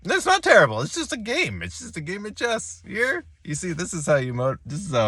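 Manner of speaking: strained high self-reassurance, softening; forced brave face. Real, not acted. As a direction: reassure yourself it's just a game. Forced cheer after losing game.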